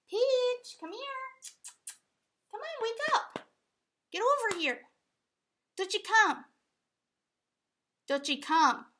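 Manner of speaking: high, loud calling of a pet; sing-song call to coax the dog over. Real, not acted.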